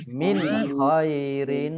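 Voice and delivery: "Min khairin" with a clearly sounded n before kh; "khairin" drawn out after a clear "Min"; clear nun, izhar. Shown as a demonstration. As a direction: recite "Min khairin", crisp n in "Min". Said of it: "dibaca jelas".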